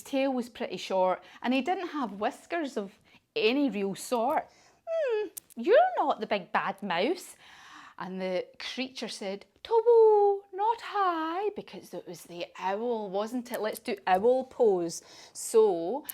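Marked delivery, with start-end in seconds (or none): 4.9-7.4 raised, puzzled little-monster voice; a hint of a wondering child monster; Gruffalo's child voice. Gruffalo's child meets the creature. 9.6-11.5 high, loud hooting owl; high owl call, then settling. Acted. Owl answers the Gruffalo's child.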